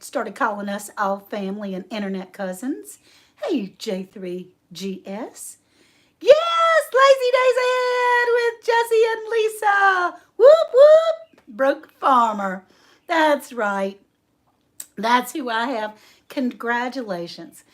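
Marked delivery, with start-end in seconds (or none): 6.2-11.4 high, loud cheering of the winner's name; excited shout. Real, not acted. Announcing the quiz winner.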